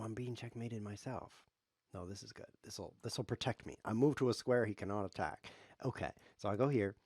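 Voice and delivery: ordinary talk, nothing marked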